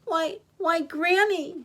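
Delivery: put-on high, hesitant little girl; distressed.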